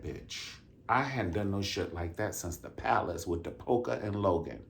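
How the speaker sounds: ordinary talk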